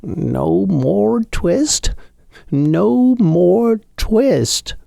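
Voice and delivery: the sick tailor talking in his sleep; feverish dream mumble. A narrator's reading. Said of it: "in his dreams he mumbled".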